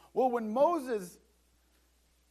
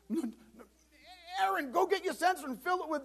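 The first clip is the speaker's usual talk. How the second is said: high, fast, near-tearful cry of no; crying plea; 9 semitones higher than usual. Acted. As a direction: beg in a rush to save the people. Moses begging God again.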